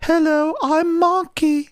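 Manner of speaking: put-on very high, slow baby voice; exaggerated baby talk; Marky. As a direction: talk like a toddler, drawn out and squeaky. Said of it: "Marky had baby voice".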